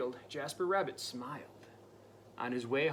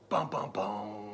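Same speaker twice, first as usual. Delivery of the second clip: creepy noise; 6 semitones lower, 5 dB louder, slower than usual. Pretend. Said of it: "Can you make a creepy noise?".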